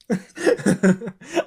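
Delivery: rising high-pitched laugh. Real, not acted. Laughing at his own tiny start.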